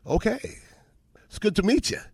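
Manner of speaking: high, tentative retold reply; wary politeness. Rookie answering a teammate's threat to knock him out, warily polite. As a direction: lift it high, politely unsure.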